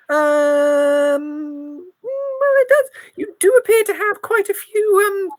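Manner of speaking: put-on very high old lady; sweet granny, quickening. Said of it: "my old granny voice".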